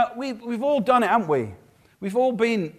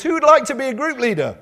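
eager recruiting call; 6 semitones higher, 6 dB louder than usual. Acted. Pastor recruits leaders, acted.

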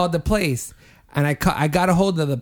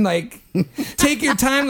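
laughing; 9 semitones higher than usual. Retold or imitated. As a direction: take your time said laughing. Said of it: "I didn't mean to".

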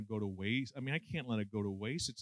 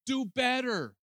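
much higher, louder scolding order; preachy command; 10 semitones higher, 5 dB louder, slower than usual. Retold or imitated. Preacher telling Christians what to do, loud stern command. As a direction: hammer it at the congregation like a stern preacher. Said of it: "Yaconelli says".

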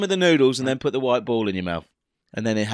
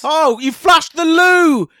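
high, loud, slow shocked cry; exaggerated startled exclaim; mock surprise; 11 semitones higher, 10 dB louder, slower than usual.

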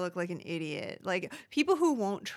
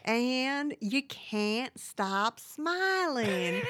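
smiling overbite voice; 5 semitones higher, much slower than usual. Shown as a demonstration. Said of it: "the key to Mindy is to talk like you have an overbite".

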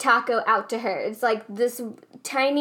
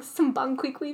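ordinary talk, nothing marked

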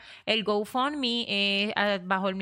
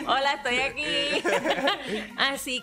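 laughing; 3 semitones higher than usual. Real, not acted. Laughing, greeting through window.

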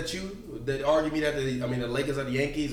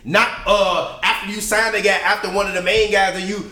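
loud rant; 6 semitones higher, 6 dB louder than usual. Real, not acted. Heated argument, loud rant.

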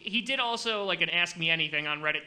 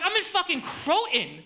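much higher, slow disbelieving outburst; incredulous; 11 semitones higher, 4 dB louder than usual. Real, not acted. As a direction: cry out in laughing disbelief. Laughing disbelief at knife offer.

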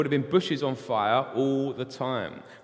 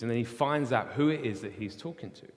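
usual speaking voice

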